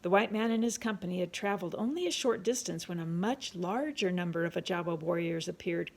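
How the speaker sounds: ordinary delivery, nothing marked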